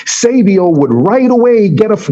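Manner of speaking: ordinary talk, nothing marked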